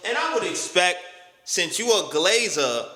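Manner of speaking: ordinary talk, nothing marked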